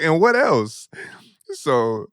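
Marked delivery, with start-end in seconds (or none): 0.0-0.9 mock stern demand. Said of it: "She was like".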